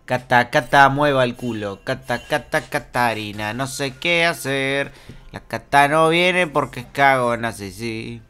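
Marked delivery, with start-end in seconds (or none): none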